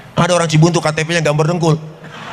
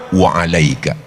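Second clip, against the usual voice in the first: low, even answer; calm reply; 8 semitones lower, slower than usual. Acted. The Prophet answers the taunt, low and calm. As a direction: hand it back calmly. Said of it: "balikin aja".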